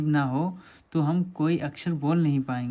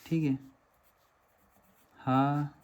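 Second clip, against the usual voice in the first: syllable by syllable; 4 dB quieter, much slower than usual. Shown as a demonstration. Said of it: "इसे ऐसे लिख सकते हैं".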